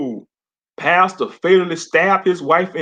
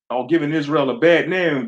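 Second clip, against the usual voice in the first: ordinary delivery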